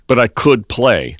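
ordinary delivery

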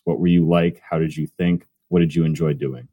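usual speaking voice

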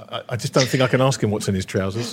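usual speaking voice

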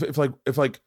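ordinary delivery

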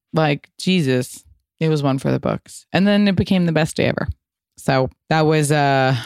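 ordinary talk

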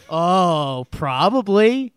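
normal talking voice

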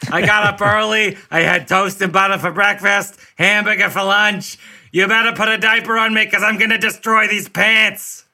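put-on high-pitched Boston toddler; Boston accent. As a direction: announce it like a cranky Boston tot. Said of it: "Boston toddlers are like".

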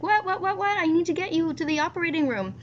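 high, startled "what, what"; flustered doctor. Retold or imitated. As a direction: caught off guard and rushing. Startled doctor pushes for surgery.

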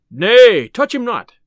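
loud, firm command to stop; sharp halt. A narrator's reading. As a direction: stop them short with one word. Robin stops men seizing tinker.

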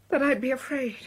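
ordinary talk

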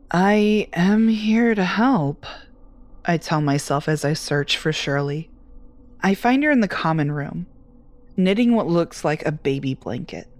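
plain reading voice

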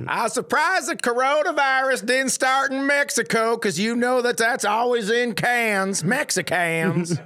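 mock hack comedian. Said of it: "seems like something you would have said".

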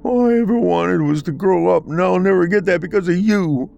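high, tearful accusation; teary, broken yell. A narrator's reading. Young boy yells at Rachel over lost childhood, teary. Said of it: "yelled in a teary, broken voice".

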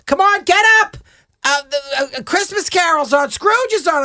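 put-on calling the kids. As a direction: rouse the kids excitedly. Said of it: "I said to the kids".

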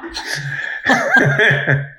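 high-pitched burst of laughter. Real, not acted. Laughs at bim bim bop.

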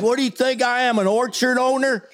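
put-on high, squeaky voices for a whiny kid and his dad; hammed-up father scolding his kid; mock kid and dad voices. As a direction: play both sides in a high cartoon pitch. Voicing dad and child, high.

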